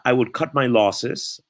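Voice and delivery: slow English phrase in an English accent. Shown as a demonstration. Vlogger switching to English for an idiom, slow English accent. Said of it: "En inglés se diría".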